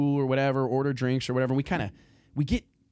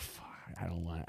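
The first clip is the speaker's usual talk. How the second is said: muttered under breath; 5 semitones lower, 15 dB quieter than usual. Retold or imitated. His muttered reaction retold.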